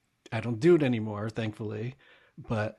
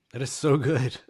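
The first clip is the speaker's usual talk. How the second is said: emphatic; 3 semitones higher, 8 dB louder than usual. Real, not acted. Repeated praise, soft then loud.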